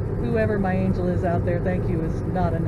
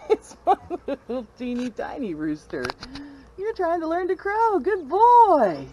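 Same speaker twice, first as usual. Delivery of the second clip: pet praise; 7 semitones higher than usual. Real, not acted. Praising the little rooster, high and sweet.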